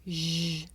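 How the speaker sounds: Zh. held as a buzzing sound with rounded lips. Shown as a demonstration. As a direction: show Zh. with lips pushed forward. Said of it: "Did you notice the shape of my lips?".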